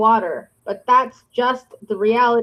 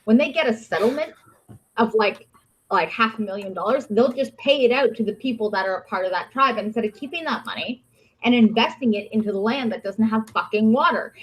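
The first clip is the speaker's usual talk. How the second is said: usual speaking voice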